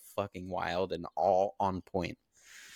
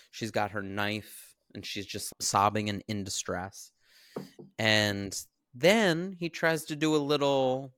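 normal talking voice

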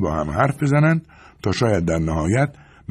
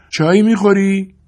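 Carla's high voice, called louder from another room; calling out; 13 semitones higher, 6 dB louder than usual. A narrator's reading. Carla calls from the kitchen to Clark, loud. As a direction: call across the house. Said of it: "از همان آشپزخانه صدا زد".

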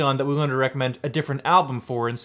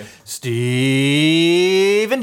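announcer sign-off; 2 semitones higher, 5 dB louder, much slower than usual. Pretend. Drawn-out sign-off.

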